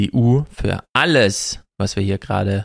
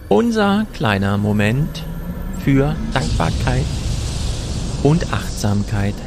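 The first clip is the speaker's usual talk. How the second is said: announcer voice. Acted. Jingle-style gratitude segment intro.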